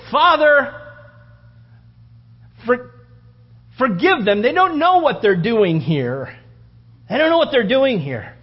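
high, loud pleading cry; martyr's loud plea under stones; Stephen praying. Retold or imitated. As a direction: cry out to the Father while dying. Stephen's dying prayer voiced.

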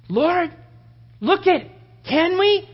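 high, eager little bursts; acted pleading. Acts out begging the Lord again.

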